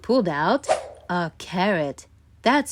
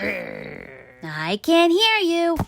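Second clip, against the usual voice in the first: slow, higher sing-song refusal; mock not listening; 9 semitones higher, much slower than usual. A narrator's reading. Stuart blocks out the tooth, slow and singsong. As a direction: drag it out like a kid ignoring. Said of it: "placing his hands over his ear".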